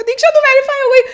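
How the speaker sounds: high, loud retold squeal of news; excited shout. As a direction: burst out the news. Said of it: "जिसमें मैंने कहा".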